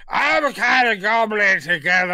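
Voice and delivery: high, loud mock Cockney mumbo-jumbo; exaggerated Cockney cabbie babble; thick Cockney gibberish. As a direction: gabble loudly in an indecipherable Cockney accent. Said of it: "it was so thick".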